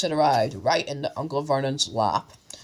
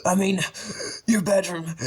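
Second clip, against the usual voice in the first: breathless, higher wheeze; wheezing; 4 semitones higher than usual. A narrator's reading. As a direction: gasp it out after the fight. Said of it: "he wheezed to Harry".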